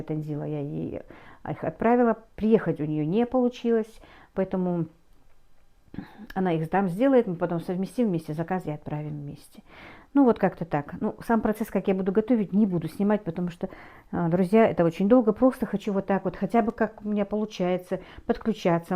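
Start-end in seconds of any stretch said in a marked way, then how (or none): none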